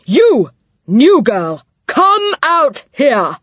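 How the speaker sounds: high, slow, loud order; stern summons. A narrator's reading. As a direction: call her out harshly. Dame summons Connie.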